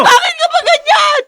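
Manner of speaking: high, loud, breaking yell; anguished shout. Acted. Wife screams at her husband for his cruelty, anguished. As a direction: hurl it at him through tears.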